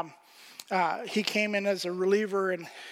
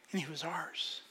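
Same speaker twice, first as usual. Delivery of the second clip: tender whisper; 3 semitones lower, 9 dB quieter than usual. Real, not acted. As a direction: whisper it, much softer. Tender close of story.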